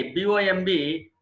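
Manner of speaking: ordinary talk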